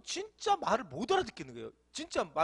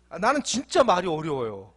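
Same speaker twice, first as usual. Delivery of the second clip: ordinary talk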